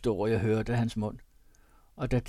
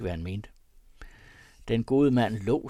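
ordinary delivery, nothing marked

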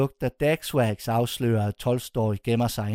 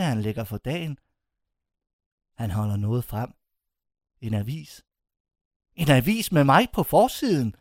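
plain reading voice

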